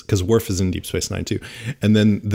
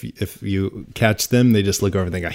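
ordinary talk, nothing marked

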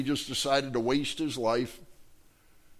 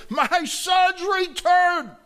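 very high, loud, slow shout of joy; joyful shout; 6 dB louder, much slower than usual. Acted. Father embracing his returned son, shouting joyfully. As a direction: cry it out to the world.

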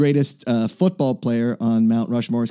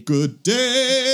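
high sing-song greeting; 11 semitones higher than usual. Pretend. Greeting birds as princess.

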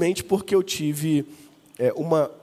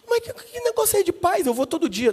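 incredulous protest; 10 semitones higher than usual. Retold or imitated. Son arguing with mother.

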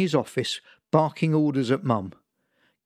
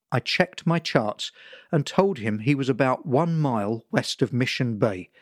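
usual speaking voice

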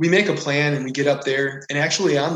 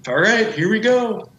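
higher, louder quoted reply; game for it; 8 semitones higher, 6 dB louder than usual. Retold or imitated. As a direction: act out raring to go, pitched up. Acts out his eager response.

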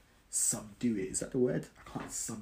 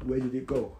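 louder, bewildered outburst; mock disbelief; 2 semitones higher, 9 dB louder than usual. Imagining looking back on five lost years, mock disbelief. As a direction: act baffled at lost time.

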